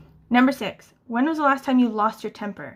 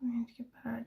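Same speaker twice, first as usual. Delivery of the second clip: whisper; 16 dB quieter, much faster than usual. Real, not acted. Muttering to herself about coping.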